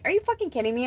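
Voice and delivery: like you can't believe what you're seeing; incredulous. Retold or imitated. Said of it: "I'm like".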